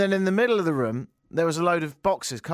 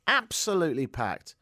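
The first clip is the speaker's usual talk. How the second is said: ordinary delivery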